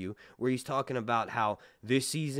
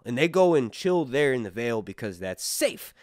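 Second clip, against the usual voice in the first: ordinary delivery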